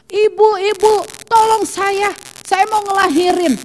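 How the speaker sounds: high-pitched retold call for help; imitated cry for help. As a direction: act out a woman calling the neighbor as labor starts. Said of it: "dia teriak akhirnya".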